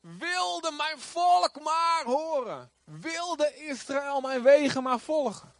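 slow, high, drawn-out calling; crying out. Acted. Preacher voicing God to his people, crying out. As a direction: stretch each word into a pleading call. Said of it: "hij roept het uit".

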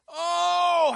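high, drawn-out calling "Oh"; crying out. Acted. Preacher voicing God's longing cry, calling out. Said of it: "hij roept het uit".

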